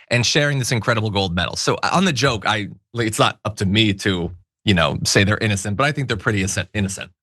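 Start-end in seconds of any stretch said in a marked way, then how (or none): none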